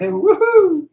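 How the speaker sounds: high, loud woohoo of joy; celebrating. Acted. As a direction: whoop it up in celebration. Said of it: "He's celebrating".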